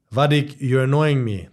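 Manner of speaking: ordinary talk, nothing marked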